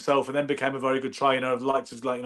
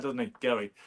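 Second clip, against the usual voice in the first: ordinary talk, nothing marked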